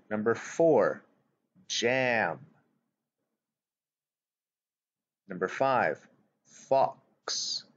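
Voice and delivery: slow, deliberate word list with long pauses; dictation read. Acted. Teacher dictating worksheet words to young pupils. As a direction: read each word clearly for the children to write.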